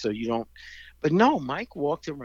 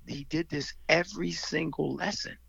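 usual speaking voice

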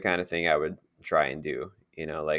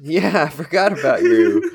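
laughing mock; 8 semitones higher, 9 dB louder than usual. Joking reply mocking old coworker.

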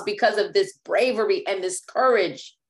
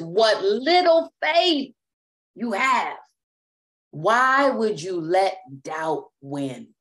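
slow, lightly voiced Jesus; a hint of Jesus, slow and searching; gentle rebuke; 2 semitones lower, much slower than usual. Jesus to a sinking Peter, slow gentle rebuke.

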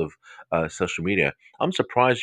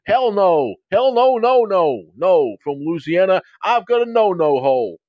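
high, loud, hammed-up Texan holler; Texan accent; 10 semitones higher, 5 dB louder than usual. Host getting carried away, mock Texan accent. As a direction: go big with a Southern Texan twang. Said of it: "can I say it in a text and accent".

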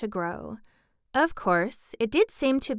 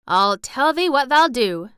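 loud, higher cheerful country lad; happy grin; 5 semitones higher, 8 dB louder than usual. A narrator's reading. Boy telling her what she'll do, cheerful. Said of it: "with his happy grin".

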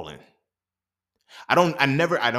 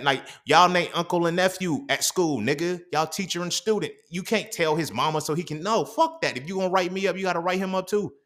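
heated rant; 7 semitones higher than usual. Retold or imitated. Ranting at the unfair teacher.

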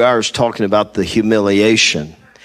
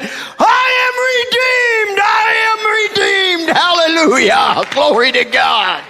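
shouted praise. Retold or imitated. Preacher relives shouting redemption at the hospital. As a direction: proclaim it at full voice. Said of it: "I went".

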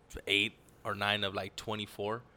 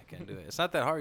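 normal talking voice

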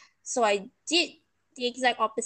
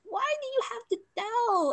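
much higher, crying-edged why; betrayed by the instructor's report, higher and tearful; near-tears protest; 11 semitones higher than usual. Retold or imitated. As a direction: plead through tears of frustration. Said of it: "And I'm just like".

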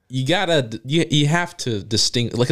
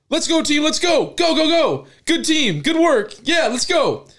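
high, excited mock chants; hyped player. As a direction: ham up a pumped-up athlete. Mocking mic'd-up player clip.

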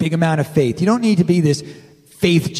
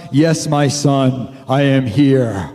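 low, slow and louder, booming from above; booming voice of God; 5 semitones lower, 3 dB louder, slower than usual. Retold or imitated. God answers the hiker from the clouds, low slow boom. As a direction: speak as God from the clouds, unhurried. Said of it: "the voice comes booming from the clouds".

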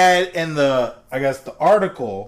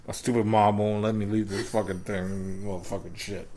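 low, quiet, laughing grumble; mock sulky voicemail; 8 semitones lower, 8 dB quieter than usual. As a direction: sulk about mom, half laughing. Mock grumpy voicemail continued.

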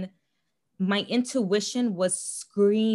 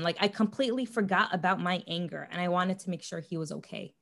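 ordinary talk, nothing marked

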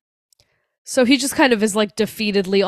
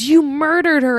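raised-pitch imitated accusation; mock accusation; 6 semitones higher, 4 dB louder than usual. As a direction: hurl a half-serious accusation. Acting out Annie's flipping-out accusation.

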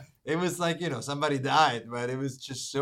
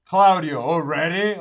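loud teasing call; 2 semitones higher, 10 dB louder, slower than usual. Retold or imitated. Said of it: "He turned around and said".

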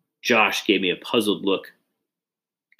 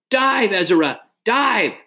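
high, urgent shouted command; high-pitched warning shout; 12 semitones higher, 4 dB louder, slower than usual. A narrator's reading. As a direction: shout it high across the water. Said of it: "David shouted".

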